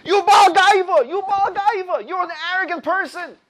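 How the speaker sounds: high, loud accusing shout; shouting accusation. Retold or imitated. Corpse on the bench shouting at the intruder, loud and high. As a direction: yell the accusation down at him. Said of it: "the person says to him".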